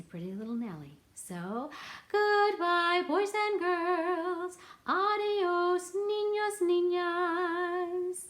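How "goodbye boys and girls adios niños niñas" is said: singsong goodbye. Real, not acted. Cheerful farewell to class.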